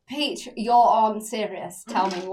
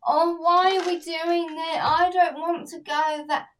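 high, slow whiny mimicry; whinging; 6 semitones higher, slower than usual. Mocking a boyfriend who whinges, whiny voice. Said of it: "if a guy I'm seeing's whinging".

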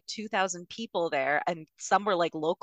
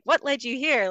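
lightly mimicked sassy question, pitched higher; Mandy Cohen, sassy; 7 semitones higher than usual. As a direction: retell her challenge with a touch of sass. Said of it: "She's all sassy with them".

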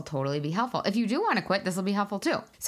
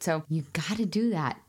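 ordinary talk

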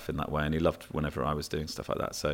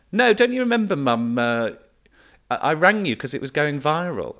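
high, louder mock protest; imitating the daughter; 9 semitones higher, 7 dB louder than usual. Daughter correcting her mum, high loud mock protest. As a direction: squeal it like an exasperated daughter. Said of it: "the daughter went".

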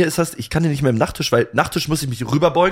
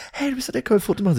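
squeaky high excited fan asking for a photo; high fangirl voice; 9 semitones higher, 4 dB quieter, much faster than usual. Retold or imitated. S0 imitating the fangirl on the train, high and eager. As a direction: play the starstruck girl, pitched way up. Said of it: "die so".

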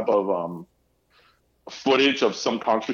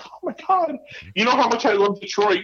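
high-pitched exclamation of mock alarm; pleading, high-pitched reaction; retold dismay; 9 semitones higher than usual. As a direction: relive the upset, voice jumping high. Acting out reaction to Detroit news.

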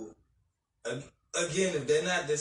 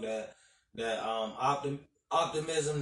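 normal talking voice

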